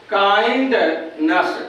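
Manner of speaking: Kindness said very slowly, every syllable drawn out; slow demo word. Shown as a demonstration. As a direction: stretch kindness out for the class to hear. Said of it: "तो आपका बनेगा". (Kindness को बहुत धीरे, हर खंड खींचकर)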